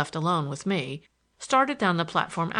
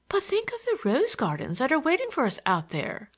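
high, swooping lady's voice; higher voice of a persuading lady; coaxing lady; 7 semitones higher than usual. A narrator's reading. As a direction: coax with a tempting, high-pitched lilt. Other lady coaxes the girl.

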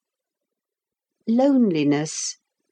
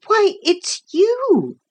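put-on high, drawn-out boyish surprise; amazed recognition; 13 semitones higher, slower than usual. A narrator's reading. Boy recognising the man he ran into, amazed. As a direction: pitch right up, slowly realising.

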